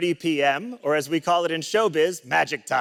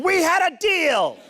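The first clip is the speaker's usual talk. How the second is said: high, drawn-out mock scream; 12 semitones higher, 4 dB louder than usual. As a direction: holler it after a runaway kid, stretching it out.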